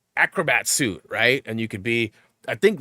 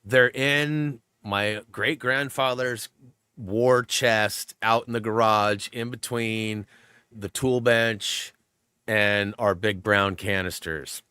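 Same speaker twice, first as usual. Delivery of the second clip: slow, deliberate certainty; slower than usual. Retold or imitated. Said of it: "she goes".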